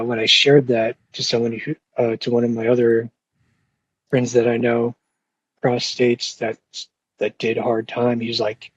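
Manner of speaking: usual speaking voice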